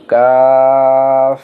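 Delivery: কাফ held long and level, without a push; natural, unforced recitation. Shown as a demonstration. Teacher showing it is said without a push, কাফ drawn out smoothly. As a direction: stretch কাফ smoothly on one even note. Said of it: "এটা স্বাভাবিকভাবে উচ্চারণ হবে".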